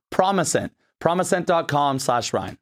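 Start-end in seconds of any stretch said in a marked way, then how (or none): none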